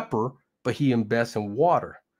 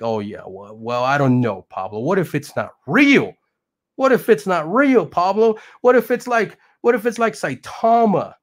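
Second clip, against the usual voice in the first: rising into a high, loud whine; mock doubting viewer; 5 semitones higher, 6 dB louder than usual. Doubting viewers whining to Pablo, higher and louder. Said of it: "you guys are like".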